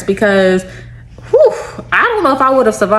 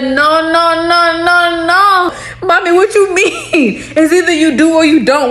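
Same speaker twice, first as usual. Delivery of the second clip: higher, insistent retold objection; mock alarmed protest; 7 semitones higher than usual. Child questioning mommy about voodoo, mock alarmed. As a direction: pile up the objection, pitch lifted.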